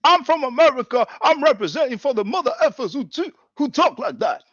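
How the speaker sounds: high, loud parody of a debater; mocking imitation. Same boast mimicked once more.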